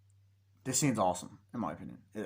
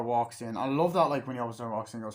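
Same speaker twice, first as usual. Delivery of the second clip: usual speaking voice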